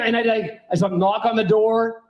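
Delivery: usual speaking voice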